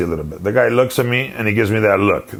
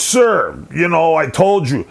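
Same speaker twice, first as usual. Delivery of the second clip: indignant higher-pitched outburst; mock exasperated protest, voice pitched up; retold losing it; 6 semitones higher than usual. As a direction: imitate a dad losing his temper. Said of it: "this time he lost it".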